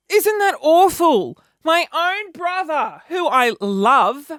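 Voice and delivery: high, loud and slow, mock horror at herself; mock outrage. Real, not acted. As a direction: play up the shame, slowly. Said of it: "self-mocking outrage".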